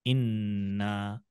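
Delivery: इन्ना very slow, nun held. Shown as a demonstration. Teacher to children, modelling the shadda. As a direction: stretch the nun of इन्ना slowly. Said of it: "दो बार नून को नहीं पढ़ना".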